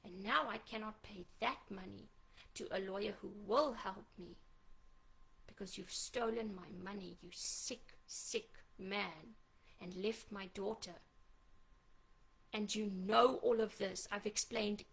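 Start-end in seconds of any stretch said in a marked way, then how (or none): 0.0-10.9 much softer, partly whispered, real hurt held down; hushed bitter account. Real, not acted. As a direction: say it low, almost under your breath. Hushed account of lawyer betrayal.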